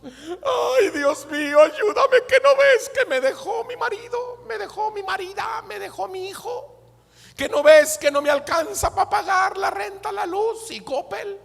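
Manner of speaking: high-pitched mock wailing prayer; desperate plea. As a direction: plead tearfully in an exaggerated, high whine. Acted desperate plea to God.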